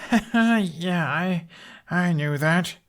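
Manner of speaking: high, embarrassed fake confidence; boy's hollow claim from behind tree; false bravado. A narrator's reading. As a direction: cover the embarrassment, unconvincing. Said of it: "disembodied voice rang falsely".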